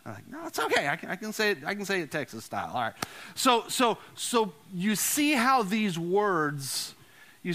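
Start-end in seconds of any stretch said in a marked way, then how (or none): none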